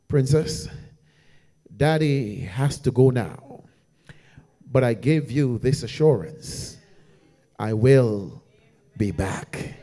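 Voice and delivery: very soft, low, near-whispered promise; tender promise. Retold or imitated. Father at the incubator promising his baby girl, tender hush. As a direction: speak gently to a sleeping baby.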